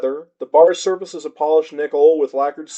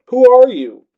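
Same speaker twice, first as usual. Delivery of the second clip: Cleo's voice; 8 semitones higher, 7 dB louder than usual. A narrator's reading. Cleo questioning Joe.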